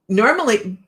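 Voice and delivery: usual speaking voice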